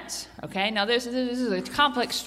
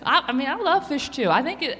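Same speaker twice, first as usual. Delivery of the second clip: ordinary talk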